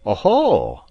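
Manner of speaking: a sharp, loud "Oho!" of sudden suspicion; surprised exclamation. Acted. Stranger catching the captain's meaning, loud surprise. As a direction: catch the hint and bark it out.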